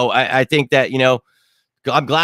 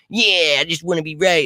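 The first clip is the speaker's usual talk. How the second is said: mock whiny voice; 6 semitones higher than usual. Mocking Liam's reply.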